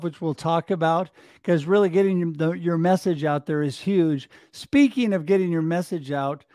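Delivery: ordinary delivery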